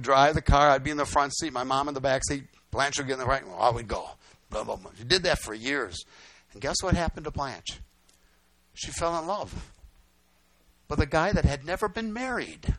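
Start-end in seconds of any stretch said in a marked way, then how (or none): none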